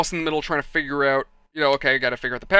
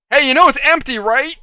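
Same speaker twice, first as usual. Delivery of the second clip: raised, higher voice, imitating the boss; calling out; 8 semitones higher, 8 dB louder than usual. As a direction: call after someone running for the exit. Boss calls to fleeing thief.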